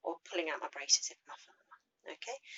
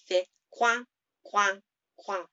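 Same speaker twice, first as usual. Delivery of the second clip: French duck sound; 2 semitones higher, 6 dB louder than usual. Shown as a demonstration.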